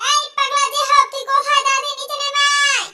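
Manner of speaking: high, loud yelling up the stairs; shouting. Acted. Grandmother yelling for her grandson to come downstairs, loud and high. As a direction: bellow for him to come down. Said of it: "এত চিল্লাচ্ছিস কেন?".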